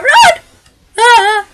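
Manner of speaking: high, loud yell to run; panicked shout. Real, not acted. Player yelling to viewers while fleeing, panicked shout. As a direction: scream it as you bolt.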